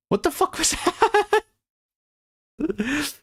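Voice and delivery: very high, laughing through it; cracking up in disbelief; squeaky incredulous laugh. Real, not acted. Laughing at baffling text.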